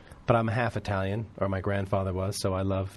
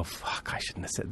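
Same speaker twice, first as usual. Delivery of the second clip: whispered "Fuck, I shouldn't have said"; hushed regret; 3 semitones lower, 9 dB quieter than usual. Retold or imitated. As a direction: breathe it out like a guilty slip.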